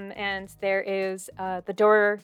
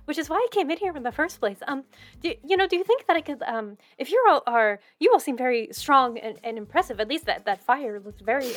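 put-on high, breathless, gushing rescued girl, often fast; rescued maiden gushing thanks to her saviours; excited young woman; 5 semitones higher, faster than usual. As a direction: babble gratefully like a flustered village girl. NPC Melody keeps talking.

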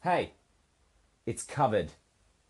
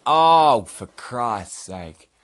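fed-up outburst; 4 semitones higher, 10 dB louder, much slower than usual. Real, not acted. Cat trouble again.

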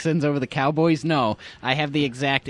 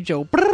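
brr shiver sound; 6 semitones higher, 6 dB louder than usual. Pretend.

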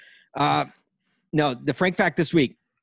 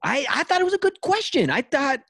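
much higher, defensive protest; defensive squeak; 12 semitones higher than usual. Real, not acted. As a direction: pipe up high to defend yourself. Defending his question.

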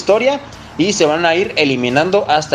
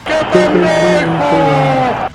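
drawn-out shout; 8 semitones higher, 3 dB louder, much slower than usual. Acted. Award for dumbest choice of week.

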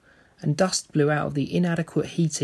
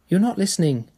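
higher, louder, irritated accusation; irritable accusation; 7 semitones higher, 6 dB louder, faster than usual. Acted. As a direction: snap the complaint at her distracted mother. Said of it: "Jenny accused her irritably".

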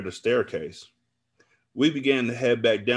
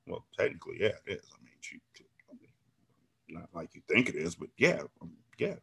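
very soft, low hesitant stammer; sheepish admission; 3 semitones lower, 8 dB quieter, faster than usual. A narrator's reading. Narrator admits it to angry friend, sheepishly quiet. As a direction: shrink back, halting and barely audible.

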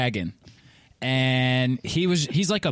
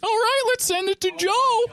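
high chirpy clown host. Acted. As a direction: hand it over like a party clown. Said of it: "clown voice".

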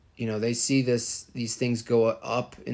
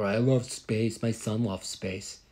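mock space mom. Mocking space fan parent.

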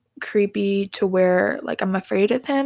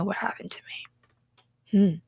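whisper; 5 dB quieter than usual. Real, not acted. Ending a spooky story.

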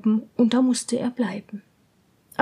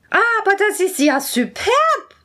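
much higher, louder enchanted princess; delighted princess; 8 semitones higher, 5 dB louder than usual. Acted. Princess hears the rattle, high and delighted. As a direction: gush over the music. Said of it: "sagte die Prinzessin".

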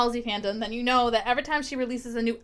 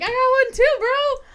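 high-pitched, loud mock-excited shout; excited bro reply; 14 semitones higher, 6 dB louder, slower than usual. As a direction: hype it up to your bro, pitched way up. Acting out excited reply to friend.

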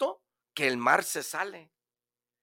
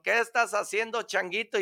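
a hint of a higher lion voice; lion asking the monkey, lightly voiced; lion's voice; 7 semitones higher than usual. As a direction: ask like a lion on the hunt. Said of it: "le contesta el león".

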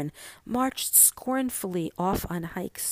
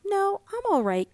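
put-on high, louder little-girl voice, out of breath; gasping girl; 12 semitones higher, 4 dB louder than usual. A narrator's reading. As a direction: brush off her mother breathlessly. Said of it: "gasped Mink".

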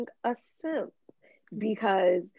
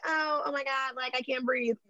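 high, soft breathless fangirl imitation; imitated starstruck swoon, hushed and high; mock hyperventilating; 5 semitones higher, 5 dB quieter than usual. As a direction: lightly act out being too overcome to breathe. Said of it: "hyperventilating over him playing guitar".

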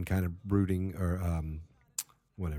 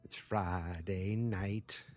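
ordinary talk, nothing marked

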